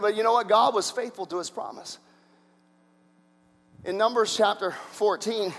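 ordinary talk, nothing marked

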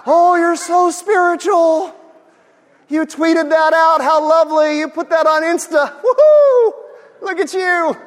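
high, loud, gushing sarcastic cheer; mocking fake praise. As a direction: ham up the fawning admirer.

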